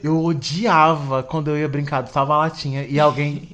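usual speaking voice

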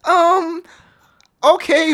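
skeptical okay. Retold or imitated. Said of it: "And I'm like".

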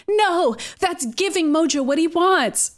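a hint of a worried hero; lightly voiced Kurt. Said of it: "Kurt is like".

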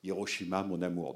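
«Hiroshima, mon amour» repris plus bas et plus doux; titre dit doucement. Shown as a demonstration. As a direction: murmure le titre «Hiroshima, mon amour» comme une évocation. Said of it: "c'est devenu un des titres les plus célèbres". (le titre «Hiroshima, mon amour» redit tout bas, plus grave)

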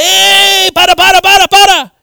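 high, very loud yell to stop; retold frantic high shouting; urgent shout. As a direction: holler in alarm from across a field. Retold man running up calling stop.

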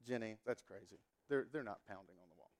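low, quiet brush-off, a bit fast; dismissive scoff. Retold or imitated. Telling his wife she's imagining it, low dismissive scoff. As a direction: wave off the worry.

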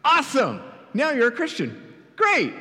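high, loud, overjoyed salesman-like pitch; eager evangelist. Acted. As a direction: gush at the new convert like a cheesy preacher. Evangelist celebrates the convert.

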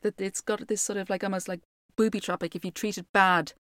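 plain reading voice

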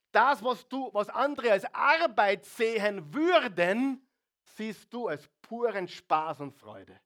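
high, loud passionate declaration; preaching at full force. Acted. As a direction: pour your whole heart into it.

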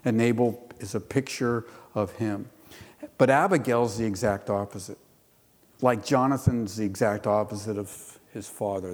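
ordinary talk

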